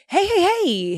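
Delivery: high, drawn-out hey; prophet's greeting. Acted. Prophet greets the man of God he found, high hailing. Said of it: "the prophet says".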